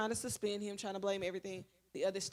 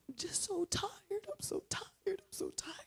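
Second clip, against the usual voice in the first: put-on tearful wail; imitated crying; 10 semitones higher than usual. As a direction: plead like a crying boy, pitched way up. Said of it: "he's on the ground crying".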